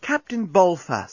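high, slow, coaxing address; most charming voice. A narrator's reading. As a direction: turn on the charm, drawn out. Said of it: "in her most charming voice".

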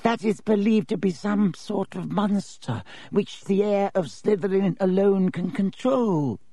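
put-on high, dry, reedy old professor; reedy ghost teacher, dry and thin. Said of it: "in his dry, reedy voice".

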